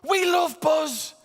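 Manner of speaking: high, loud, slow delight; excited crew member. Retold or imitated. Crew member cheering up a crying child, loud and gleeful. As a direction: gush over the boy's toy. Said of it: "this member of Crab and Crew said".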